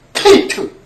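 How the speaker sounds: 케이트 barked out high with the tongue pressed flat to the palate; 케이트 with a hard, forceful front k; 케이트 as a sharp, strong stop; strong emphatic sound. Shown as a demonstration. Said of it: "이렇게 아주 강한 소리가 나니까요".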